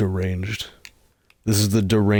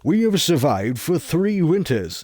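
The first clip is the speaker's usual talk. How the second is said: higher, louder grandiose fantasy line; mock fantasy epic; 7 semitones higher, 6 dB louder than usual. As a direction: proclaim it like a solemn fantasy hero. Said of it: "they just call it".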